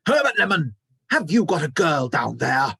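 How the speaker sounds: high, loud accusing snap of a boss; suspicious boss demands an answer; angry Mister Mollusk. A narrator's reading. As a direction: bark at the boy accusingly. Manager turns on the boy.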